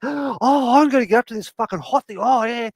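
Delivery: high, fast, excited cat voice; gasping, high-pitched glee; totally excited. Acted. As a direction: act out a cat thrilled beyond words. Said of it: "She was, like, totally excited".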